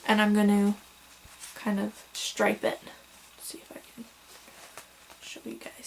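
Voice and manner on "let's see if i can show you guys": soft unvoiced whisper to the camera. Real, not acted. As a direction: breathe the words without voice. Showing yarn to camera.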